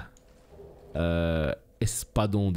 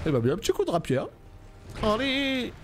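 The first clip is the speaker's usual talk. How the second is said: frantic; 10 semitones higher than usual. Real, not acted. Frantic end of the boss fight.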